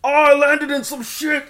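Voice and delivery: loud, high comic panic; hammed-up alarmed stoner shout; Seth Rogen. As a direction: stoner yelling over a mishap. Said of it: "Can you do Seth Rogen?".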